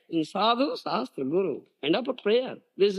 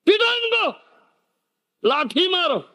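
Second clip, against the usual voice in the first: mock order to beat; 10 semitones higher than usual. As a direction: call for the stick in mock fury.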